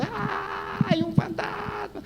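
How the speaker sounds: very high, drawn-out mock scream; screaming in fear. Rugged Pedro spotting a ghost, high terrified scream. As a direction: shriek like a tough man terrified. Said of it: "ahí estaba gritando".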